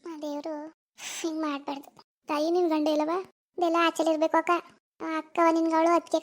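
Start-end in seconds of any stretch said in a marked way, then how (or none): 0.1-1.9 quiet lament. Acted. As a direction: say it under your breath, sorrowful. Quiet lament after girl's cry.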